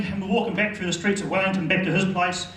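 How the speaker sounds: ordinary delivery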